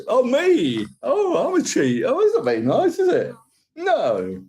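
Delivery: high-pitched playful indignation; mock offended. Streamer answers a viewer calling him a cheat, mock offended. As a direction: protest in a high, hurt mock voice.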